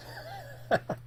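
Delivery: sudden high-pitched laugh. Real, not acted. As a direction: burst into a squeaky laugh. Laughs at bad start.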